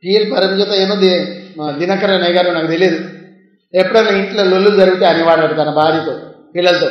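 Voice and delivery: ordinary delivery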